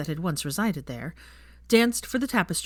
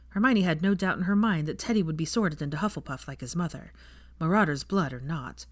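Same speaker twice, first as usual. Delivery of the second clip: plain reading voice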